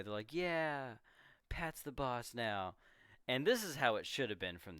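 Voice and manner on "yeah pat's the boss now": mild cheer, high on the first word; mock joyful exclamation. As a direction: imitate delighted kids without shouting. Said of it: "exclaiming with joy".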